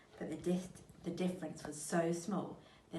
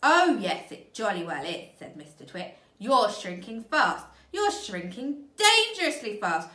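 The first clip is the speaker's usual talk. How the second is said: much louder, higher jeering; bully her loudly; 5 semitones higher, 13 dB louder than usual. Acted. Mister Twit loudly jeering at his frightened wife.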